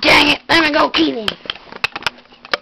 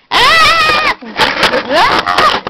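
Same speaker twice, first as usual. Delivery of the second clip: high, loud scream; 7 dB louder than usual. Pretend. Toy screaming as the monster attacks, high and loud. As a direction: shriek in pretend terror.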